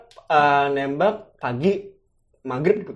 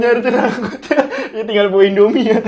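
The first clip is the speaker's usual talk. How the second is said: laughing; 5 semitones higher, 10 dB louder than usual. Real, not acted. Laughing while joking back.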